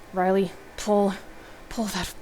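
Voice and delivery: strained, halting, pained delivery; through gritted teeth. A narrator's reading. Heather in obvious pain urging Riley, through gritted teeth. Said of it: "says through gritted teeth".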